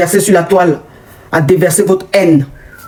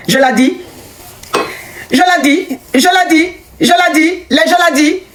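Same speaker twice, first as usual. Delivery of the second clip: mocking told-you-so; 7 semitones higher than usual. Real, not acted. Repeating her point, mocking.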